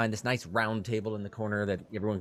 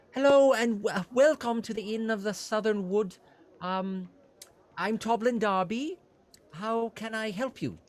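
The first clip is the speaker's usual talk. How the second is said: high, eager innkeeper voice; fussy innkeeper; 13 semitones higher than usual. Acted. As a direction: play a flustered, helpful innkeeper pitched high.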